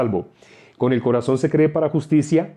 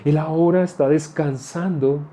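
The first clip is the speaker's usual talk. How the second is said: soothing consolation. Acted. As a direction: lull a grieving mother gently and slowly. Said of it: "le habla de una manera hermosa".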